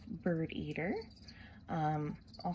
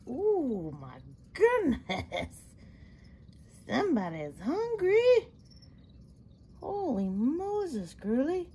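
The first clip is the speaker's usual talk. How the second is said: amazed cooing; 5 semitones higher, 8 dB louder, slower than usual. Real, not acted. Feeding spider, amazed cooing.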